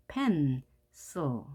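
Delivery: "pencil" broken as pen, pause, cil; split syllables. Shown as a demonstration. Teacher divides between consonants, "pencil" with a gap after pen. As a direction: say "pencil" in two separate pieces. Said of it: "그 사이를 갈라라".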